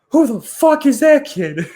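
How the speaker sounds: very high, loud, incredulous shout; hammed-up astonished holler; imitated yell. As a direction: yell it in disbelief at a teammate. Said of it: "like, yelling at Connor Murphy".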